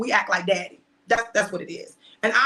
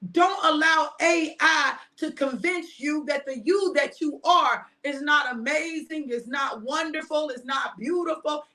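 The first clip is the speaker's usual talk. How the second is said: fervent preaching; 6 semitones higher than usual. Acted. Preaching to viewers about self-worth.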